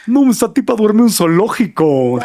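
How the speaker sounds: mock a strong Mexico City accent; marked Mexico City accent. Host retelling a friend's jibe, exaggerated Mexico City accent. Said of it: "un amigo que tiene un acento muy marcado".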